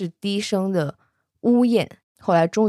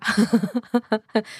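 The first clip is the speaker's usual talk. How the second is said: higher-pitched run of laughter; amused laugh; 4 semitones higher than usual. Real, not acted. Narrator amused by the emperor's blunt answer, laughing. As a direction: keep laughing, tickled.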